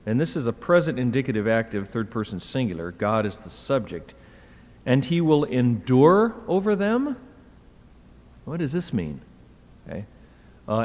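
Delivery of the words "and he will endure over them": "endure over them" climbing high at the end; puzzled question. Shown as a demonstration. Lecturer testing a translation that makes no sense, puzzled. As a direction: query "over them?" with a rising, doubtful tune.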